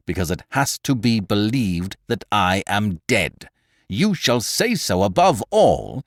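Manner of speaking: slow, each syllable hammered out; every syllable stressed. A narrator's reading. Lupin pressing Beautrelet's shoulders, stressing every syllable. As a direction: spell out each word with weight. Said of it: "emphasizing every syllable".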